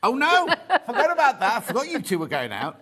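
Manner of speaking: high, loud oh no with a laugh; sudden dismay. Real, not acted. Realising she forgot the couple, high loud laughing dismay. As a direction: catch yourself mid-gaffe, laughing. Realises gaffe about friend's partner.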